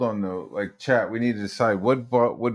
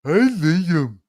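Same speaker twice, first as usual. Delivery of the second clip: higher, louder put-on wife voice; wife's voice; 4 semitones higher, 3 dB louder than usual. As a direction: play his wife calling him. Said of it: "in Liam's wife's voice".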